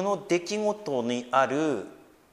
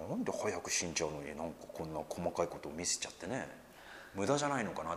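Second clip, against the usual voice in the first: quiet inner musing; 8 semitones lower, 13 dB quieter than usual. Retold or imitated. Private doubting thought quoted aloud.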